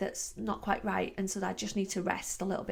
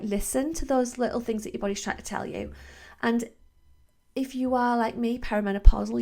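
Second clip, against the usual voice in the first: usual speaking voice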